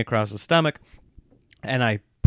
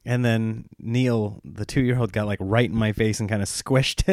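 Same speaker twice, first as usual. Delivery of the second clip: ordinary talk, nothing marked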